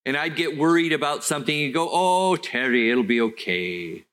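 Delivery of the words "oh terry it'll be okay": put-on Welsh accent. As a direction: stretch it out in a fatherly Welsh accent. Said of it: "he had this Welsh thing going on".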